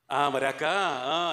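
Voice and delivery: mock stalling, syllables stretched; long hesitant "ఆ", as a rambler would; drawn-out hedging. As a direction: imitate someone dragging out their words. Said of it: "అని సాగదీస్తారు వీళ్ళు".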